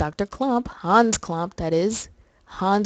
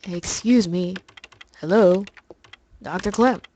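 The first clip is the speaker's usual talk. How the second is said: lightly put-on character voice; lightly acted detective seeking someone; Daniel; slower than usual. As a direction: tentatively call for someone's attention, lightly in character. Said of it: "me doing the voice of Daniel".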